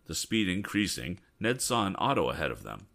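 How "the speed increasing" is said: plain reading voice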